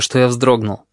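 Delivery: usual speaking voice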